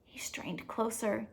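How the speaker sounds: hushed whisper, leaning in. A narrator's reading. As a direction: breathe it as if listening close. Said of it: "Was the faint bubbling a whisper".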